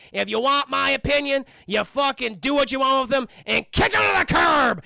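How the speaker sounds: mock rant building up; ranting father. Father telling son to dump her, mock shouted rant.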